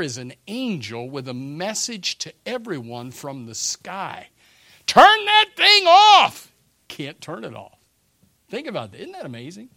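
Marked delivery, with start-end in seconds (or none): 4.9-6.5 slow, high, loud furious shout; angry yell. Retold or imitated. People on earth yelling at the preaching angel, furious. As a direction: bellow at the sky to shut it up. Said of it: "Can you hear people yelling".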